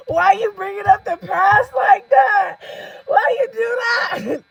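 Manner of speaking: high, loud, drawn-out squeal; helpless shrieking protest; squealing through laughter. Real, not acted. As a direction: cry it out while cracking up. Cracking up at the memory.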